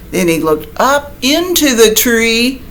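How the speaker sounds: ordinary delivery, nothing marked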